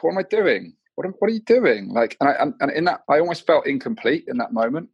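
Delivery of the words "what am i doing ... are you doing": self-scolding. Retold or imitated. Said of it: "I was like".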